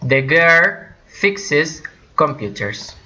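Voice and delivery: very slow, word by word; teacher's slow, separated example; slow model sentence. Shown as a demonstration. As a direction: spell out the example slowly for learners.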